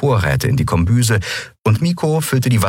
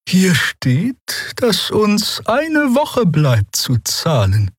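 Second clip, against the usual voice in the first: gentle; 13 semitones higher, much slower than usual. A narrator's reading. Draxyl reads the letter to the quarrelers, gently. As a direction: take your time, high and soothing. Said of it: "sagte die Draxyl sanft".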